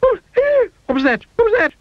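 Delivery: very high, squeaky alarm; frightened shriek. A narrator's reading. Mister Jelly hears a snore, frightened shriek. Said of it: "shrieked Mister Jelly".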